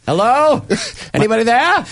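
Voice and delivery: high nervous call down hallway. Retold or imitated. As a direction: call out into the dark. Calling down the hallway.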